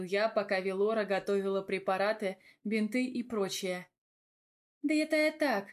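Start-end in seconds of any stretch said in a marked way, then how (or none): 4.8-5.7 higher-pitched brush-off; dismissive. A narrator's reading. Velora brushes off her boyfriend's teasing, dismissive. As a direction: wave the remark away. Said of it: "отмахнулась Вилочка".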